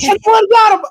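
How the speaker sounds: high, loud mock fan cry; Brinson impression. Guest repeating his Jets fan bit, Brinson impression. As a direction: send the pitch way up in protest. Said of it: "It was my Brinson voice".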